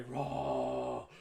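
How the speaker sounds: loud drawn-out mock crowd roar. Goblin crowd answers the announcer, imitated roar. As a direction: roar like a whole arena. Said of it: "the crowd roars uproariously".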